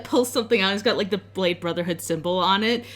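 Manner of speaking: ordinary talk, nothing marked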